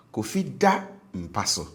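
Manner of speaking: DA lifted high in "Kofi da mpa so". Shown as a demonstration. Teacher drills the tone contrast, DA high. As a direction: raise DA, then fall on "mpa so". Teacher alternating the two tones.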